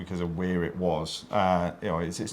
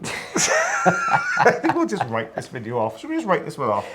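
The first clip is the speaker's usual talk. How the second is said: laughing; 8 semitones higher, 4 dB louder than usual. Real, not acted. Giggling fit over blooper-filled video.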